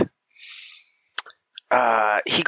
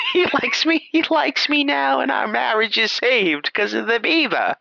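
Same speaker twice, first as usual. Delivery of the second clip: high, swooning wife voice; the wife pleased her marriage is saved, pitched way up; mock gushing wife; 11 semitones higher than usual. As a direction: gush in a high, slow mock of the happy wife. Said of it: "quoting the wife".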